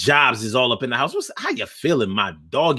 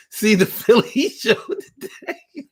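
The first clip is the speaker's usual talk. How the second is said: laughing through the quote; 7 semitones higher, slower than usual. Retold or imitated. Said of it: "Joe says".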